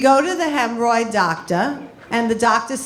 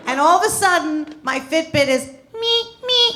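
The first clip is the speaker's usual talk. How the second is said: ordinary talk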